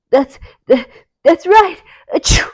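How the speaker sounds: Sneezy stifling a sneeze; muffled. A narrator's reading. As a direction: hold back a sneeze then burst. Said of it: "in a muffled voice".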